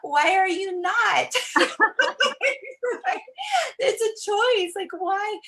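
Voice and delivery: high, loud, laughing oh my gosh; delighted exclamation. Retold or imitated. Coach laughing at a doubter asking why she's so happy. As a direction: burst out laughing in happy disbelief. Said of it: "And I'm like".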